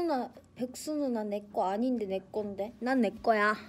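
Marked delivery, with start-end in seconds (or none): none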